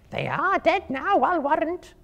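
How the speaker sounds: a spider sure the prey is dead; high spider voice. Acted. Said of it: "said the first".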